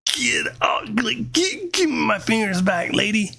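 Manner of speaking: high, strained mock plea; straining while pulling. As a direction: act out tugging hard as you speak. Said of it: "So I'm pulling".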